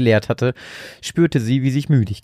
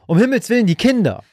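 much higher and louder cry of alarm; panicked shout; 11 semitones higher, 4 dB louder than usual. A narrator's reading. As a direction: shout it, terrified for the children. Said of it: "schrien beide im Chor".

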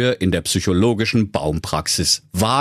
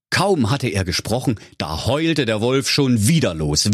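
ordinary delivery, nothing marked

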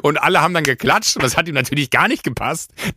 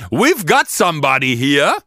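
slow, loud showman call; mock MC announcement; 3 semitones higher, 4 dB louder, slower than usual. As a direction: announce it to the room like a host. Host announcing over microphone.

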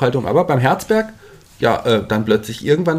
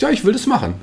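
much higher, mock eager quote of the tall Dutchman; imitating the big Dutchman eagerly volunteering, pitched way up; mock eager applicant; 12 semitones higher than usual. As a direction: squeak out his keen yes like an eager kid. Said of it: "kommt so ein großer, gestandener Holländer ... und sagt".

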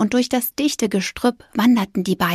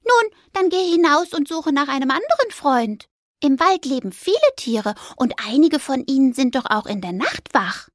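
high squeaky mouse voice; little mouse; 6 semitones higher than usual. Acted. Mouse urging the ghost on, high and squeaky.

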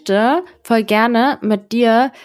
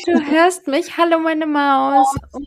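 tender goodnight voice; 5 semitones higher than usual. Real, not acted. Talking sweetly to sleepy child.

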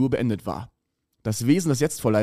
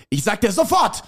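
not whispered, higher; raised; 8 semitones higher than usual. Acted. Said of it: "flüsterte der Mann bedrohlich".

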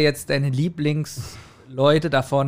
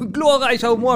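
a hint of a raving fan shouting praise; higher, louder, hammed-up cheer; playful mock enthusiasm; mock cheering listener; 9 semitones higher, 4 dB louder than usual.

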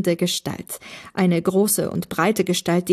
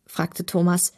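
ordinary delivery, nothing marked

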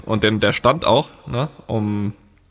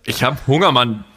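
mouth full. Real, not acted. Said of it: "Du frisst nebenbei".

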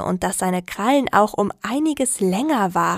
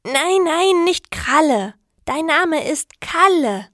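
higher, insisting on Kalle; correcting; 8 semitones higher, slower than usual. A narrator's reading. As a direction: stress Kalle firmly, slowly. Sarah correcting.

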